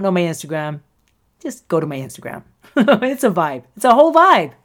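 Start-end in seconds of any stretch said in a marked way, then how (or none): 3.7-4.5 higher and louder, hyping it up; gleeful hype; excited. Real, not acted. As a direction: sell the vibe with a burst of glee. Hyping up her Instagram.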